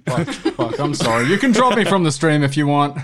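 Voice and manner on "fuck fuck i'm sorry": ordinary talk, nothing marked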